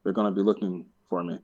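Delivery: low, quiet movie quote; imitated film line. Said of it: "makes me think of the hobo in Batman Begins".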